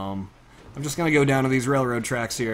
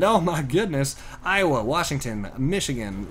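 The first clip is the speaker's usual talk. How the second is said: usual speaking voice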